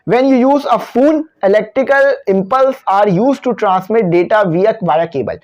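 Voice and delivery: reads the English line very slowly, word by word; slow reading aloud. Shown as a demonstration. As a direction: read the textbook sentence slowly for students. Teacher reads the textbook line.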